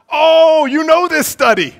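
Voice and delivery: high, very loud outburst; loud exclamation. Real, not acted. Preacher reacting to an audience who knows the study, loud high exclamation.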